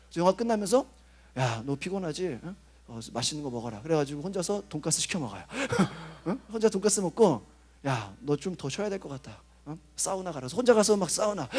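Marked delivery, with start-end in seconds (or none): 1.4-3.8 hushed, fond pampering of oneself; tender self-care. Retold or imitated. Pastor coddling himself. 7.8-10.5 low, soft self-indulgent advice; soft, fond nudge to oneself; gentle self-care. Retold or imitated. Pastor coddling himself.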